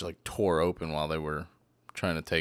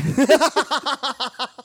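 loud, high burst of laughter; long helpless laugh; big laugh; 10 dB louder than usual. Real, not acted. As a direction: crack up at your own joke. Laughing at his own joke.

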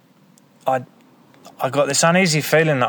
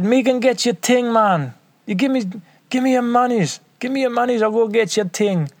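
put-on Jamaican accent; 12 semitones higher than usual. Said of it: "this fucking crazy Jamaican West Indian accent".

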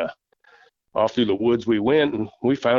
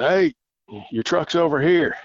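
retold yell; 7 semitones higher than usual. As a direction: shout over to the hunters so they find their truck. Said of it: "and I yelled".

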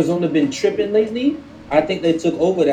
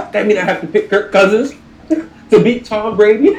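laughing through the words, a bit louder; 5 semitones higher, 5 dB louder than usual. Real, not acted. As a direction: chuckle while you explain the absurd pick. Laughs at his own pick logic.

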